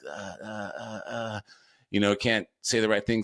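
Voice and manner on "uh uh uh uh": imitated stammer. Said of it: "my poor dad is just always like".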